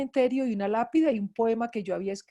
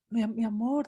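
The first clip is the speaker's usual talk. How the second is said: ordinary delivery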